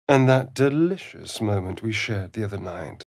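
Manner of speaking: ordinary talk, nothing marked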